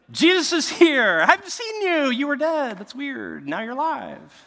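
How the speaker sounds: high, loud whooping, easing off; acted joyful greeting. Disciples greeting Jesus back alive, acted excitement. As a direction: shout the welcome, then drop to comic aside.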